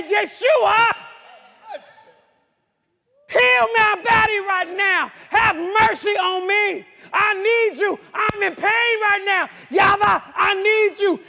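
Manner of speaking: high, loud desperate prayer; re-enacted anguished cry to God. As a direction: shout out a plea for healing. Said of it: "I cried out".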